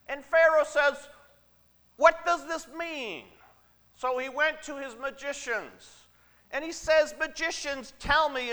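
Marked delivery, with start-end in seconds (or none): 2.0-3.3 high, loud Pharaoh demanding an answer; raised-voice royal demand; acted Pharaoh. Pharaoh demands meaning of dream. 7.2-8.5 high, raised-voice royal summons; acted ruler's order, pitched up; commanding Pharaoh. Pharaoh commands his magicians.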